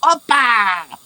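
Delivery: high, loud showman cheer; goblin cook's "Oppa!". Acted. Second goblin cook showing off at the grill, loud cheer. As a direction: belt it out like a teppanyaki chef. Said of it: "the other one next to him goes like".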